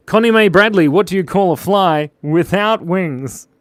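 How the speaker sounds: high, loud call-out, slowing into the riddle; loud joke announcement. Real, not acted. Streamer putting a joke to a named viewer, loud announcement.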